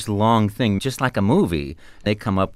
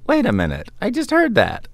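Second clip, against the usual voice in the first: put-on surprised kid; 12 semitones higher than usual. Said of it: "kids can look at me".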